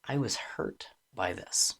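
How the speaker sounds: low, soft, vulnerable confession; subdued and exposed, without sarcasm; hurt admission. Shown as a demonstration. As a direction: quietly own the hurt. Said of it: "rather than".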